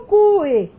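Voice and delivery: cue split in two, CU-e; hiatus, stressed U. Shown as a demonstration. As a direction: stress the U in cue, then a separate e. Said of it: "você tem uma separação".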